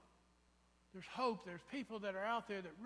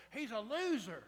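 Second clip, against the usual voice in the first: jeering crowd; 4 semitones higher, 7 dB louder than usual. Retold or imitated. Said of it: "they were going".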